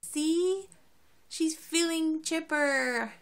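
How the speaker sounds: high, loud, drawn-out excitement; excited. Real, not acted. Owner showing off her recovered dog, excited. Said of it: "So I'm so excited".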